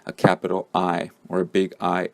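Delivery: ordinary delivery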